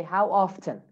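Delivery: American "often" with the T sounded; American accent. Shown as a demonstration. Teacher models American "often" for students, clear T. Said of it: "In American accent".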